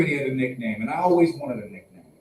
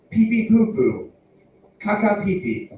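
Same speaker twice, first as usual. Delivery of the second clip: silly potty talk; 6 semitones higher than usual. Pretend. Silly toilet-humor voice as dumb joke.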